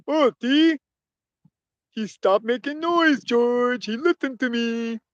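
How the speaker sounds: high, put-on dim-witted oaf voice; pleased simpleton. Simpleton telling George the puppy listened, innocently pleased. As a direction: say it innocently proud, in an oafish voice.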